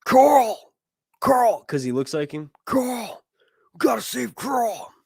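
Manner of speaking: high, drawn-out cry of a name; mock TV dad yelling Carl. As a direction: holler it high like a hammy impression. Mimicking Walking Dead character calling Carl.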